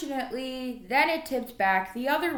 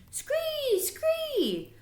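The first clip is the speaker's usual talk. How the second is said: very high screeching scree; piercing high frog cry; frog scream. A narrator's reading.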